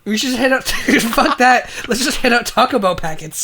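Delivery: giddy with the joke; laughing. Real, not acted. Riffing on Taco Bell packets with cohost, laughing.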